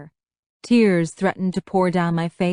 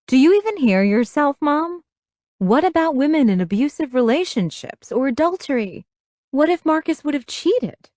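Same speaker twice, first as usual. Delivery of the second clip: higher-pitched exasperated questioning; indignant challenge; 8 semitones higher, 3 dB louder than usual. A narrator's reading. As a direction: challenge her with pointed questions. Daughter argues back at mother.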